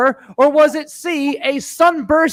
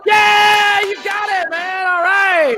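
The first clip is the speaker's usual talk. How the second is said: excited cheer; 7 semitones higher, slower than usual. Real, not acted. Host cheers correct answer.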